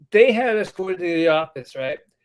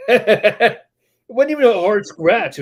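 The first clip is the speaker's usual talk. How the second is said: laughing slowly through the words, higher and louder; 6 semitones higher, 8 dB louder, much slower than usual. Real, not acted. Laughing off his friend's joke about the scratch, laughing. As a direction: laugh it off while protesting.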